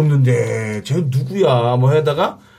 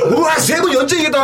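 과장된 환호 흉내; 11 semitones higher than usual. Retold or imitated. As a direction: imitate an MC hyping the crowd. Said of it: "막 우악 하는 거야". (높고 길게 우와 환호하는 흉내)

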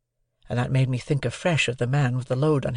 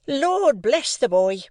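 high, louder, slow exclamation; exclaiming sister; 12 semitones higher, 4 dB louder, much slower than usual. A narrator's reading. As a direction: exclaim it, half exasperated. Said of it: "exclaimed my sister".

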